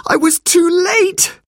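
high, slow cry of dismay; boy's high, anguished exclamation; alarmed cry. A narrator's reading. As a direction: cry out in horror at your failure. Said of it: "cried Robert".

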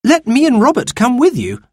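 put-on a boy trying to tag along; worried boy. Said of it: "suggested Cyril".

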